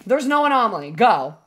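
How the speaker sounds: ordinary talk, nothing marked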